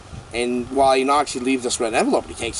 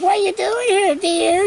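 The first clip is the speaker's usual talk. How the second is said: very high, far put-on nosy old lady. Nosy old lady in hospital asking, high granny voice. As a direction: croon it like a busybody granny.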